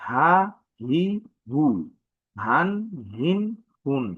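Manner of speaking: each syllable said short and separate; Arabic vowel drill. Shown as a demonstration. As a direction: model each sound for the class, crisp. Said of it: "যদি আমরা বিভিন্ন উচ্চারণ দেখি".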